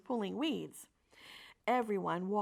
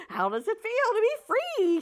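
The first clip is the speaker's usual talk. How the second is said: high, louder gleeful question; excited goose; 13 semitones higher, 7 dB louder than usual. Acted. Goose asking the freed pig, high and gleeful. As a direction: crow it out, delighted.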